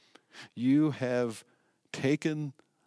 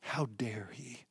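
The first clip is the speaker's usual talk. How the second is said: slow, hushed whisper of offended priests; whispered outrage; 8 dB quieter, slower than usual. Acted. Priests muttering to each other about Jesus, whispered outrage. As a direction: hiss it under the breath, scandalised.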